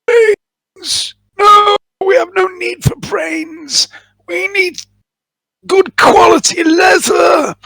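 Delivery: high, slow, shouted undead voice; zombie voice. Acted. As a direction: moan it out like a hungry zombie.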